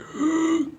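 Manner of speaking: high gasping intake like the start of a yawn. Shown as a demonstration. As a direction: open the throat in a yawn-gasp. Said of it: "as long as you don't swallow it".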